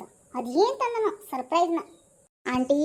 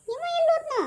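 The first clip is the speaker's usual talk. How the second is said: jealous husband; 11 semitones higher, faster than usual. Acted. Jealous husband spying on wife.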